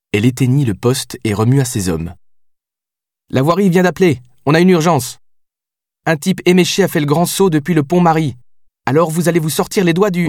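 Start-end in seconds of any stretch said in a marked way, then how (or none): 3.3-10.3 high, pressing, exasperated orders. A narrator's reading. Captain shaking up her idle men, exasperated. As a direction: chivvy the team into an emergency. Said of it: "Exaspérée par ce laisser-aller".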